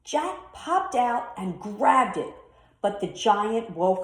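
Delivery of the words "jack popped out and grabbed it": usual speaking voice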